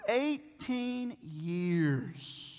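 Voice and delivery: EIGHTEEN YEARS drawn out very slowly; slow, weighty "eighteen years"; stress on eighteen years. Shown as a demonstration. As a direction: stretch "eighteen years" word by word. Stressing how long the woman suffered.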